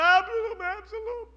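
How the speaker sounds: high, brief imitation of the grieving cry; echoed wail. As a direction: quote the lament back at the hearers. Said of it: "Do you cry out".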